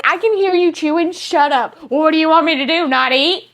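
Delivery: loud back-and-forth. Retold or imitated. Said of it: "I'm like".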